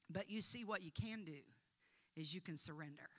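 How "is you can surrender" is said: ordinary talk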